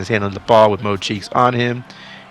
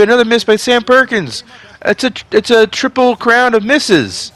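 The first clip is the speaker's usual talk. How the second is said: much higher, louder announcer call; excited call; 11 semitones higher, 4 dB louder than usual. Acted. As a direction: sell the dunk like a big moment. Dunk call, game slipping away.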